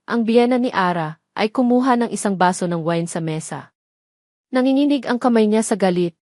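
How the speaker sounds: usual speaking voice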